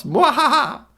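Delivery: high evil mwahaha laugh; sneering panto villain. Acted. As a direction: cackle like a stage villain.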